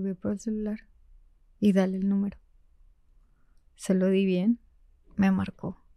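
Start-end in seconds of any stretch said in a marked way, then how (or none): 0.0-2.4 much softer inner voice; quiet conscience nudging; Pepe Grillo. Retold or imitated. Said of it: "Y Pepe Grillo". (voz interior muy baja)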